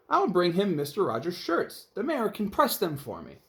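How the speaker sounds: sweetly literal housekeeper explaining; cheerful Amelia Bedelia. Acted. Narrator voices Amelia Bedelia.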